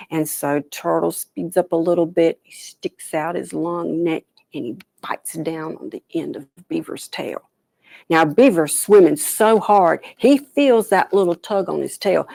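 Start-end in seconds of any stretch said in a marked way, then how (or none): 4.5-7.5 hushed, sneaky telling; whispered. A narrator's reading. Sneaky bite in the story.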